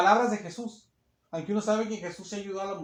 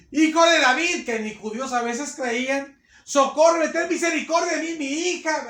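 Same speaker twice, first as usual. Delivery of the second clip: high, loud begging; pleading mother; 5 semitones higher, 6 dB louder than usual. Acted. The mother crying to the Son of David, loud high pleading. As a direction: plead for mercy for her daughter. Preacher voicing the woman's plea.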